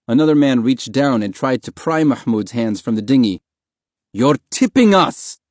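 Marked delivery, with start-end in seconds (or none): 4.1-5.4 high, slow, loud warning; alarmed yell. A narrator's reading. Passenger to boy clinging on, alarmed yell. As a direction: shout it in alarm, stretching the words.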